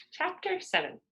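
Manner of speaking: ordinary delivery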